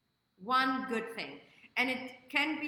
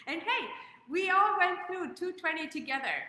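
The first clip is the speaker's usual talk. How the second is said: high and loud through laughter; bubbling over with laughs; laughing while cheering everyone on; laughing excitedly; 8 semitones higher, 6 dB louder than usual. Real, not acted. Excited recap of the year.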